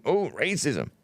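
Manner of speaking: a sneering fake-concerned "oh, racism"; mock pretend outrage. Vlogger mocking companies who pretend to care, sneering. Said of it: "They're pretending".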